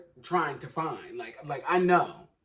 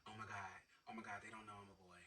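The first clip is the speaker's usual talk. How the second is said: hushed, fast inner panic, much lower and softer; panicked inner thought; 8 semitones lower, 25 dB quieter, much faster than usual. Retold or imitated. Telling how he panicked in his head at work. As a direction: panic under your breath.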